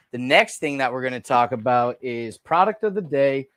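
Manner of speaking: ordinary delivery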